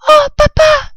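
put-on squeaky-high, loud excited child; exclaiming fox cub. A narrator's reading. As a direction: cry out in thrilled little-cub voice. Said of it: "s'écria-t-il". (renardeau qui s'écrie, voix suraiguë)

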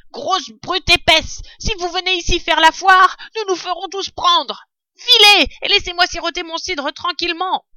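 high, loud, indignant rat scolding; vociferating rat. A narrator's reading. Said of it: "vociféra le rat". (rat qui vocifère, voix haute et indignée)

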